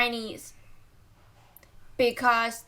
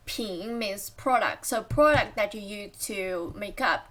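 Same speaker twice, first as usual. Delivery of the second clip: ordinary talk, nothing marked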